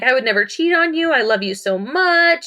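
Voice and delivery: dramatic plea. Retold or imitated. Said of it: "big dramatic scene".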